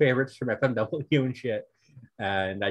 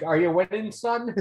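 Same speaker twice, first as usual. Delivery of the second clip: meme dad voice; 8 semitones higher than usual. Retold or imitated.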